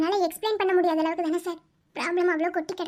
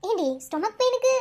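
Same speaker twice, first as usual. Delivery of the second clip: usual speaking voice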